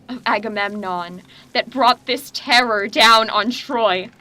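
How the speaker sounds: ordinary talk, nothing marked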